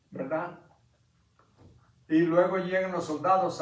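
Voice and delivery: ordinary talk, nothing marked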